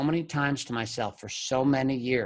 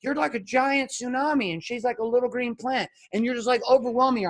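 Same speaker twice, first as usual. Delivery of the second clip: high-pitched imitation of a therapist; therapist's analogy; 9 semitones higher than usual. Therapist explains the husband to himself, mimicked high voice. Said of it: "and she'd be like".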